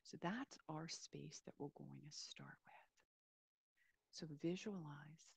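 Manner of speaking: calm, unhurried meditation voice with long pauses; guided meditation. Real, not acted. Yoga teacher easing listeners into a body scan, guided meditation. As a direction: settle listeners gently into a body scan, leaving space between phrases.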